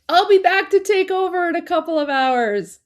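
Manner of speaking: imitating Armin. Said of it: "Armin's like".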